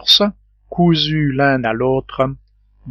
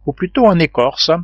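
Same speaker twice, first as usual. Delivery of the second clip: ordinary delivery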